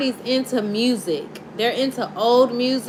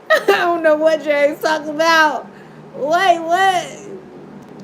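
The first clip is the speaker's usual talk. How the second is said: laughing disbelief; 3 semitones higher, slower than usual. Real, not acted. Tarot reader laughing, baffled by the message.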